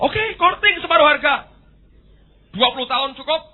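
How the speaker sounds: high, loud offer; booming God. Acted. God loudly offers the monkey half. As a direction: grant it grandly.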